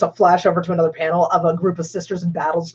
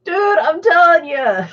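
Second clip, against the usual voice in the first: half-laughing plea; 10 semitones higher, 4 dB louder, slower than usual. Real, not acted.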